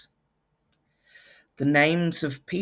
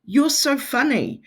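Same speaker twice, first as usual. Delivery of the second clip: gushing compliment; 9 semitones higher than usual. Shown as a demonstration. Fond example sentence.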